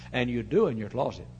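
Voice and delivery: usual speaking voice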